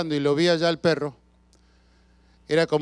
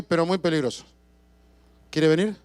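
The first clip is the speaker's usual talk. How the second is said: ordinary talk, nothing marked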